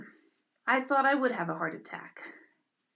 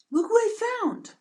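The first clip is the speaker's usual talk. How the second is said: husband's proud call; 11 semitones higher, 3 dB louder than usual. Retold or imitated. Husband showing her a snake.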